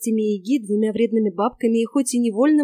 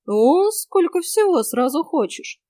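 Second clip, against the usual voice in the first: higher, slow mock amazement; grumbling exclamation; 7 semitones higher, 4 dB louder, slower than usual. A narrator's reading. Hostess reacting to bogatyr's many wishes, grumbling. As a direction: draw it out as a grudging complaint. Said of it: "буркнула я".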